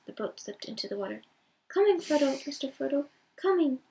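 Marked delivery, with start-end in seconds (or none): 1.7-3.8 loud, high desperate call. Acted. Sam racing to the drifting boat, calling out desperately. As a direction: call after the departing boat. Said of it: "called Sam".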